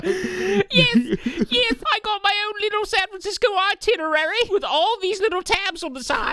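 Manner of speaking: put-on high-pitched boy who sounds like a grandpa; boy voiced like an old man. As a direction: play the boy as a chirpy little old man. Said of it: "He talking like he's an old man".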